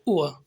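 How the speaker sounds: ऊ then अ, said low and separate; ua sounds demo. Shown as a demonstration. Teacher sounding out the diphthong. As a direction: model ऊ अ as one glide. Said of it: "ऊ और अ sound".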